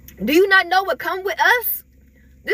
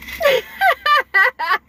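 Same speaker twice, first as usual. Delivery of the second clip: a burst of high laughter; short high giggle; 7 semitones higher than usual. Real, not acted. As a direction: laugh high at your own joke. Laughs at own impression.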